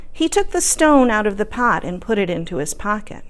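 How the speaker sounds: usual speaking voice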